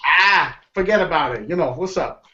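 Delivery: light Brooklyn tough-guy; lightly hammed Brooklyn phrase; Brooklyn accent. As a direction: lean into a New York wiseguy. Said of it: "do the Brooklyn accent".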